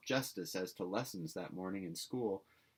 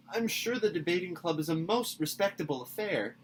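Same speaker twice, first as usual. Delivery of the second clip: put-on higher, earnest girlish plea; pleading; 10 semitones higher, 7 dB louder than usual. A narrator's reading. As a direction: beg her to reconsider. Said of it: "pleaded Anne".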